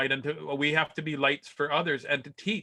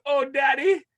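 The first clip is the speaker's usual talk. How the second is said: put-on much higher, louder squeaky child voice; little kid voice; 7 dB louder than usual. Dad imitates his son walking out after the crash, squeaky high child. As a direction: pipe it up like a dazed little boy. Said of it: "He's like".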